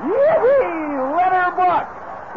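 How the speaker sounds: very high, distant whoops of a rodeo crowd; cheering crowd. Acted. Rodeo crowd cheering a bronc rider, high distant whoops. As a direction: whoop it up from the stands.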